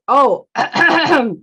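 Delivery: flustered oh with a throat-clear; embarrassed. Acted. Father Mouse realizes who Konezumi is, embarrassed. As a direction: stall awkwardly, clearing the throat. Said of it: "with a little bit of embarrassment".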